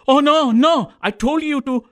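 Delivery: high, urgent "oh no, no"; retold doctor's alarmed high-pitched "no, no"; alarmed correction. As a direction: rush to set the old man straight. Doctor correcting patient's misunderstanding.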